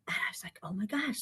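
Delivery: soft unvoiced whisper of amazement; a hushed, amazed whisper. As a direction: breathe it out in awe. Awed retold reaction.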